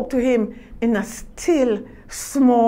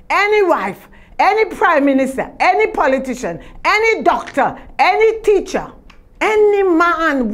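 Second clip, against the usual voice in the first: rising preaching litany; 4 semitones higher than usual. Acted.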